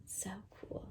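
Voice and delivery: whispered in wonder; hushed, marveling whisper; awed whisper. Real, not acted. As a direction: breathe it out amazed.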